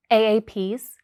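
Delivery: ordinary talk, nothing marked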